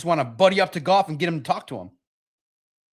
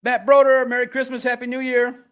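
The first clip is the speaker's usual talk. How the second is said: hearty shout-out; 6 semitones higher, 4 dB louder than usual. Real, not acted. Calling out greeting.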